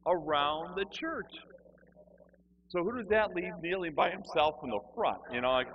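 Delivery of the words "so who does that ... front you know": ordinary delivery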